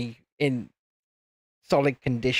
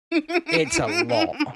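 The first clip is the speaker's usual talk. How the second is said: laughing; 10 semitones higher, much slower than usual. Real, not acted. Teasing, laughing.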